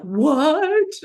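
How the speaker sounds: high, loud, stunned question; mock astonishment from the judges; shocked what. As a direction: judges hearing he forgot, high and loud in disbelief. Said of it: "And they're like".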